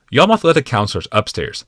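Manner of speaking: usual speaking voice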